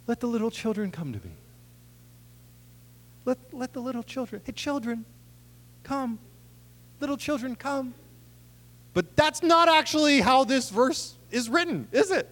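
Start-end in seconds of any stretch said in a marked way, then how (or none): 0.1-7.9 soft, quick and high, like arms wide open; gentle, welcoming. Shown as a demonstration. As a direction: coax the children in tenderly and softly. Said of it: "heard it with arms wide open".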